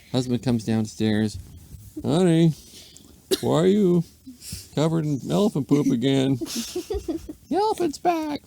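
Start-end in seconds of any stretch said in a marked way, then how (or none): 2.0-6.4 husband's voice. A narrator's reading. Husband asks wife. 6.4-8.4 very high, slow squeaky voice; weary lady. A narrator's reading. Wife telling husband the elephant is back, very high weary squeak.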